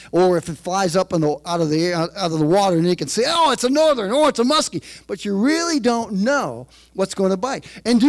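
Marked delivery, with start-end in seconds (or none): 3.3-4.8 excited discovery. Retold or imitated. Fisherman seeing the catch.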